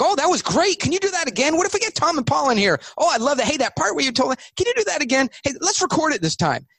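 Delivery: hammed-up thrilled board members; gushing excitement. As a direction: imitate fans begging for an encore. Board members gush over the pitch.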